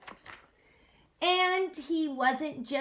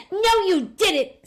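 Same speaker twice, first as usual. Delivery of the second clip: high, loud indignant retort; snapping; 9 semitones higher, 12 dB louder than usual. Acted. Sam snapping at the monster, angry. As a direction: snap back at him. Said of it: "snapped Sam".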